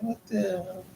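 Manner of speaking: hushed, high "what the hell"; quiet incredulous aside; stunned disbelief. Retold or imitated. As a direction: mutter it under your breath, floored. Reacting to an insult online.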